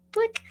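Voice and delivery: a quick high zap to banish the gremlin; sudden high-pitched blick. Retold or imitated. Coach shooing her gremlin George away, a high-pitched blick. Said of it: "I have to go".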